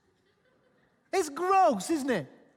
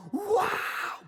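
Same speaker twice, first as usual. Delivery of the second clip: amazed wow; 10 semitones higher than usual. Retold or imitated. Disciples marvel at risen Jesus.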